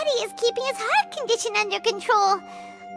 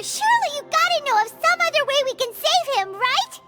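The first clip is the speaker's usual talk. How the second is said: high-pitched, frantic protest; distressed pleading; 11 semitones higher than usual. Acted. Friend begs doctor to save him, distressed.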